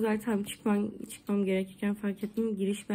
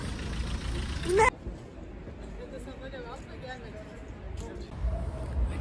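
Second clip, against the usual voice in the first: nervous laugh; 3 semitones higher, 7 dB quieter than usual. Real, not acted. Long laugh after forgetting her ID.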